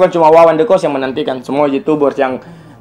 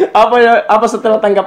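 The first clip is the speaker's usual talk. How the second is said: ordinary talk, nothing marked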